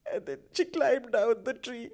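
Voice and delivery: high, tearful wailing, speeding up at the crash; mock weeping. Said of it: "I was actually weeping real tears".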